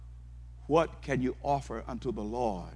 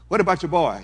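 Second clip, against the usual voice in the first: higher, louder prodding question; imagined questioner; 5 semitones higher, 9 dB louder, faster than usual. Acted. Preacher voicing someone asking God about his son, higher and louder. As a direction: poke at him, higher and louder.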